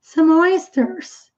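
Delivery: "Some oysters" stretched slowly, M joined to "oysters"; linked demo. Shown as a demonstration. Teacher demonstrates the linking for the class, slowly. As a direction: draw out "Some oysters" as one linked sound. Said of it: "acaba o som do M emendando aqui".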